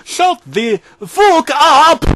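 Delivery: slow, drawn-out Irish yell; Irish accent scream. A narrator's reading. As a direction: bellow it in a thick Irish accent. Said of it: "she screamed at Finkelstein in a thick Irish accent".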